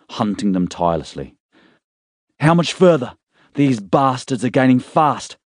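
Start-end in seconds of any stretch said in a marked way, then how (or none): 2.4-5.4 high, louder anxious demand; raised tense voice in pursuit; urgent shout. Acted. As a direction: yell it over the engine noise. Jack during chase.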